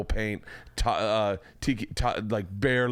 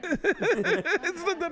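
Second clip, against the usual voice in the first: giggling through the words; laughing; much slower than usual. Real, not acted. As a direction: laugh while saying it. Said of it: "laughing mock accent".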